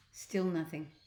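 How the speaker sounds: ordinary delivery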